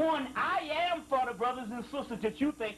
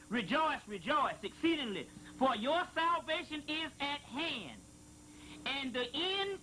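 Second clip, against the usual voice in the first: raised, solemn reading voice; scripture reading; 3 semitones higher than usual. Acted. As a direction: intone it like holy text. Reading a sacred passage aloud.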